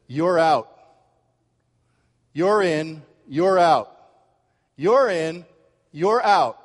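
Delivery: a stranger sorting a crowd with loud calls; stranger's verdict. Retold or imitated. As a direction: point and pronounce them in or out. Stranger pointing at subway passengers.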